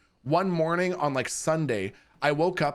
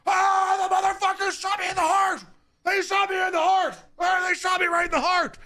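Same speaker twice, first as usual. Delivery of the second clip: much higher, louder mock yell of a raving man; imitated screaming neighbor; 3 dB louder than usual. Streamer imitates the naked neighbor screaming in the yard, high hammed-up yell. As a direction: bellow it like a man howling in his yard. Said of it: "he's going".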